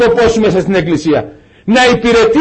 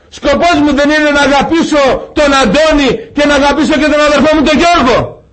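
raised, searching pulpit questions, one after another; fervent preaching; 5 semitones higher than usual. Acted. Preacher challenging the brothers, raised and fervent. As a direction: press the congregation with each question.

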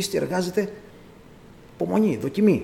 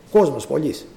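ordinary talk, nothing marked

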